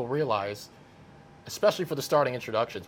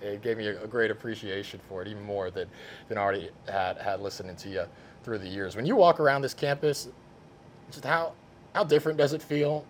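usual speaking voice

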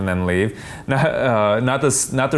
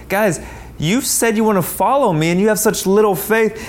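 higher, exasperated rebuke to the disciples; a teacher fed up with his students, pitched up; exasperated Jesus; 11 semitones higher than usual. Retold or imitated. Jesus scolds his frightened disciples, exasperated and higher.

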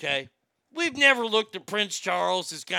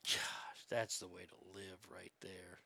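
whispering; 12 semitones lower, 19 dB quieter than usual. Real, not acted. Quiet aside to himself.

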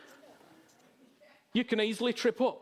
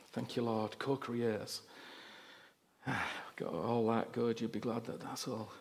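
much softer, lower murmured thanks; quiet prayer; 9 semitones lower, 8 dB quieter than usual. Real, not acted. Preacher quietly thanks the Lord mid-sermon, much softer and lower. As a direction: drop into a private murmur to God.

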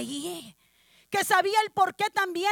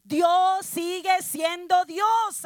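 proclaiming; 6 dB louder, much slower than usual. Real, not acted. Sermon climax proclamation.

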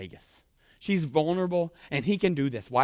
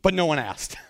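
ordinary talk

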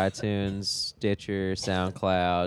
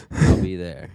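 ordinary delivery

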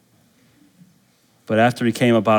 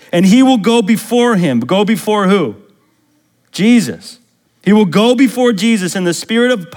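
higher, louder preacher's reading; emphatic scripture reading; 9 semitones higher, 5 dB louder than usual. Acted. Preacher reads prophecy of John to the church, higher and emphatic. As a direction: proclaim the verses with rising and falling force.

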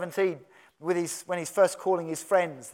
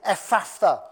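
forceful sighed-out command, a little spitty; louder, heavily exhaled word; deep sigh; 3 semitones higher, 6 dB louder than usual. Retold or imitated. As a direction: heave the word out on a strong breath. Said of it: "with a deep sigh".

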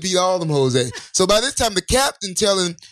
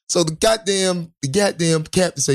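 ordinary delivery

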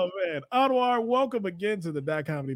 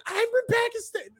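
high mock voice. Voicing audience member reply.

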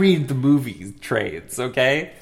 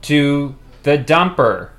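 loud announcement; 2 semitones higher, 10 dB louder than usual. Acted. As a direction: proclaim it to the room. Announces bathroom trip grandly.

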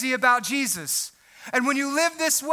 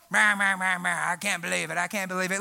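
low, soft whiny "meh" moaning; grumbling TV watcher; 8 semitones lower, 5 dB quieter than usual. Retold or imitated. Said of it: "grumbling about the world".

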